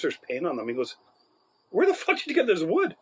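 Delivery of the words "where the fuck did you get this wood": high mock angry question; angry teacher. Teacher seeing painted wood, higher mock angry question. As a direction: demand it like an outraged teacher.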